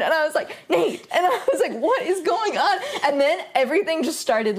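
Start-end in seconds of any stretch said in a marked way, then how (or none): 0.7-2.8 high-pitched, laughing mock alarm; concerned. Girlfriend asking boyfriend what is happening, playfully alarmed. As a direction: retell your alarm, pitched up and half laughing. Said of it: "I was concerned".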